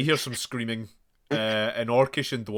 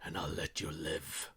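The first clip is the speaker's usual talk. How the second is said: lower, softer menacing threat; 5 semitones lower, 10 dB quieter than usual. Acted. Assassin threatening the player with a knife, low threat. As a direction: hiss it close behind him.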